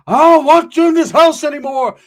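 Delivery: high shrieking loud rant; Scarface's mom. Retold or imitated. Angry uncle kicking nephew out, shrieking loud. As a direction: shriek it like an outraged mother. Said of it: "thought he was Scarface's mom".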